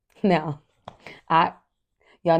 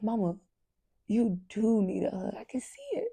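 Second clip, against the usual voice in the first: gentle daughter; 3 semitones higher, 4 dB quieter than usual. Retold or imitated. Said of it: "She says".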